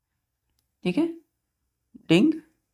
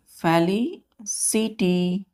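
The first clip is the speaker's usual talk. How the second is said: Felicity very slowly, split as "feli, ci, ty"; broken into parts; much slower than usual. Shown as a demonstration. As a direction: pause between the parts of felicity. Teacher sounds word out in parts.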